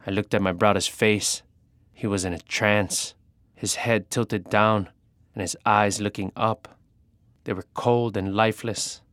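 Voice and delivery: plain reading voice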